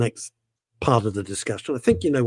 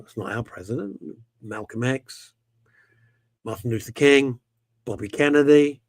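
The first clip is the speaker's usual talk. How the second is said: usual speaking voice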